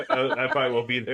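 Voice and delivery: quoting through laughter; laughing quote. Retold or imitated. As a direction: giggle through the line. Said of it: "but he's like".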